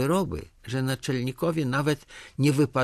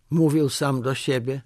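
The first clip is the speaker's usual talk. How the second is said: usual speaking voice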